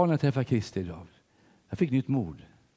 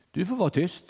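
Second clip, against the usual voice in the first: doctor's order; 7 semitones higher than usual. Retold or imitated. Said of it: "sa hon".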